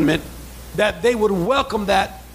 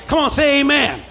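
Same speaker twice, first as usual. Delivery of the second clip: preaching at full force; 7 semitones higher than usual. Real, not acted. Pastor urging the church to shout amen, preaching full force. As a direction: rouse the congregation to answer back. Preacher calling congregation to respond.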